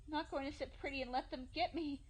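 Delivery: high, loud, half-crazed resolve; manic. Acted. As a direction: shout it with wild determination. Manic resolve after panic.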